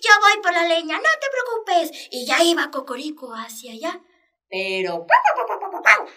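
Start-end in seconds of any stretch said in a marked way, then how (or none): 0.0-2.1 high, fast chick voice, eager to help; eager little chick. Acted. Chick tells his mother he'll fetch wood, high and eager. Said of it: "se apuntó y dijo". 5.1-6.1 clucking interruption. Acted. Mother hen clucks, stops him.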